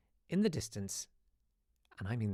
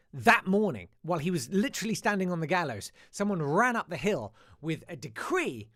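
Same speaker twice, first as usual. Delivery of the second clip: excited storytelling; 6 semitones higher, 7 dB louder than usual. Retold or imitated. Dramatic climax of pirate story.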